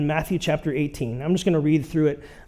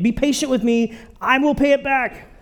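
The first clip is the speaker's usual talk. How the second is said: higher, pleading servant voice; a touch of mock desperation; desperate plea; 7 semitones higher than usual. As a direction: beg like a man who knows he can't pay. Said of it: "which there would be a snicker".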